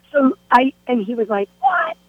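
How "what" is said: high-pitched mock outrage; squeaked, incredulous retort, retold; upset disbelief. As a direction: imitate a stunned boyfriend, pitch shooting way up. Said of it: "he was so upset".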